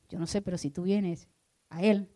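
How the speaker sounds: ordinary talk, nothing marked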